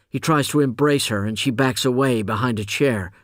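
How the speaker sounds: plain reading voice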